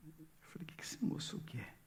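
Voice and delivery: breathy whispered aside to himself. Retold or imitated. Preacher whispering his private worry about the prisoner. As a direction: whisper it under your breath. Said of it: "unvoiced whisper".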